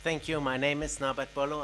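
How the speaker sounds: usual speaking voice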